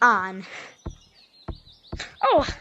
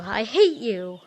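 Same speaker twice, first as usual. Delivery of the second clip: normal talking voice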